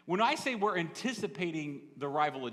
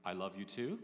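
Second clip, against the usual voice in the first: a softer, lower, hesitant reply, rising like a question; unsure; 8 semitones lower, 7 dB quieter than usual. Retold or imitated. Wife answering her husband's I love you, doubtful. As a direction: sound unsure of your own I love you. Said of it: "Like, like she wasn't sure".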